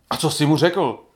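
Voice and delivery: put-on quick, eager blurt from an impatient boy; a child's sudden eager blurt. A narrator's reading. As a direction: spit the question out impatiently. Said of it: "Vyhrkl Václav".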